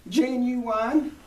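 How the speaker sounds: high, loud, drawn-out character voice; slow, high-pitched huckster's pitch; Mister Haney. Acted. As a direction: play the sidekick salesman, stretched out loud and high. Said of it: "I always have to think of his voice".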